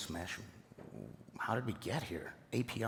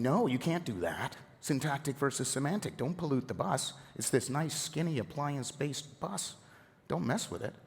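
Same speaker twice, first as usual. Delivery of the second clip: higher-pitched, insistent retold objection; mock protest; 6 semitones higher than usual. Team telling folks not to pollute the bus, protesting. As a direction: act out pushing back on a bad request. Acted team refusing users' requests.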